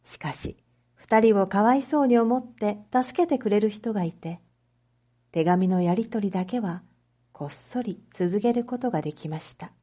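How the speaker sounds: ordinary delivery, nothing marked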